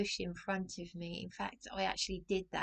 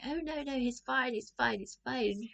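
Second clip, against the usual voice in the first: higher, hurried mock reassurance; apologetic English politeness; 5 semitones higher than usual. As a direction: brush off the injury, terribly apologetic. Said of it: "he's so apologetic".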